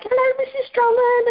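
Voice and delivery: put-on high, sing-song child greeting; former pupils' greeting. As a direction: chirp it like an old pupil. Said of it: "they're like".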